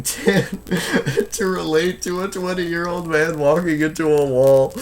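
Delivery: laughing while reading. Real, not acted. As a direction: try to read through a fit of laughter. Reads chat message while breaking into laughter.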